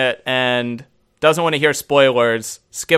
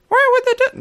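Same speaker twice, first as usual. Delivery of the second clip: high, whiny nerd voice; squeaky nasal geek impression; faster than usual. Shown as a demonstration. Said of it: "what's my nerd voice?".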